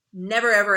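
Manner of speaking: never, ever punched loud and high; stress on never ever. Real, not acted. Teacher warning peer reviewers, never, ever stressed loud. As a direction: hit never, ever hard. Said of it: "emphatic teacher instruction".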